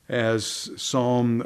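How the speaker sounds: usual speaking voice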